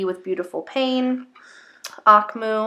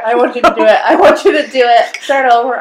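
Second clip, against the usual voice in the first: gleeful egging on; 3 semitones higher, 13 dB louder, faster than usual. Real, not acted. Egging co-host on to repeat a title, gleeful and loud. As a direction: goad a friend through your laughter.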